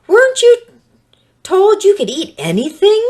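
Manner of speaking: high, loud, sly serpent. Acted. Serpent coaxing the woman, high and loud. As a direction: tempt her in a strange high voice.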